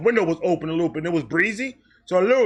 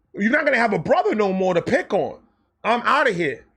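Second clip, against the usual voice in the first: usual speaking voice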